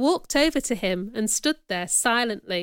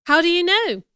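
surprised; 7 semitones higher, 5 dB louder than usual. A narrator's reading. Surprised wife asks husband.

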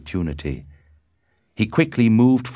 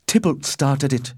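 higher-pitched earnest voice; Benvolio's defence; 8 semitones higher, 3 dB louder than usual. A narrator's reading. Benvolio blames Tybalt before the Prince, high and earnest.

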